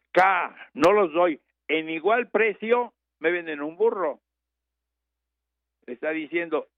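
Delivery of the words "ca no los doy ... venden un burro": stubborn refusal. Retold or imitated. Farmer refuses to pay.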